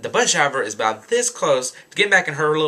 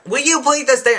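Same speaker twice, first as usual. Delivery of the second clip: high, louder mock outburst; exasperated friend; 10 semitones higher, 4 dB louder than usual. Friend to the girl who won't stand, exasperated mock outburst. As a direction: imitate her friend losing patience, voice pitched up.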